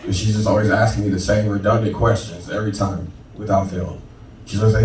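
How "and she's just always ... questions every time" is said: usual speaking voice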